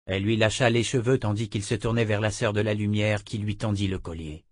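usual speaking voice

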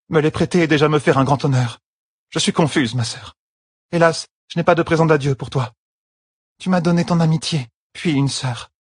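high voice, humbled and touched; humble thanks to a sister; moved Avienda. A narrator's reading.

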